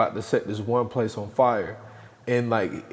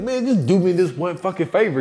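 higher, mock exasperated pleading; acted-out pushy plea, pitched up; retold argument; 7 semitones higher, 4 dB louder than usual. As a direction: imitate a friend pleading mid-argument. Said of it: "sitting there arguing".

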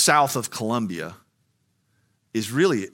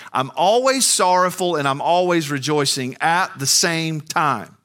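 climbing higher and louder into the call; preaching; 7 semitones higher, 6 dB louder than usual. Real, not acted. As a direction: build the preaching to full force. Preaching at full force.

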